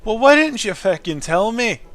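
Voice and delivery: high, loud mock outrage; indignant shout at the character; outraged shout. Real, not acted. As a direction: yell it at the screen in disbelief.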